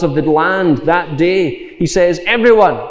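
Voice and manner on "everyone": louder, higher call to the whole city; royal proclamation. Retold or imitated. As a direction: announce it like a king to his people. King proclaims a citywide fast.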